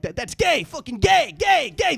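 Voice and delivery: high taunts, rising as they repeat; climbing, repeated jeer; mock jeering gamers. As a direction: imitate online players heckling. Imitating gamers jeering on a headset.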